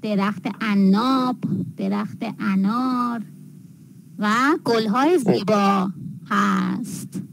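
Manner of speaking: measured, slow dictation pace; slow model sentence. Shown as a demonstration. Teacher giving a student an example about the garden, slow modelling. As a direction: spell out each phrase slowly, with pauses between items.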